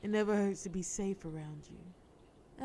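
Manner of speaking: normal talking voice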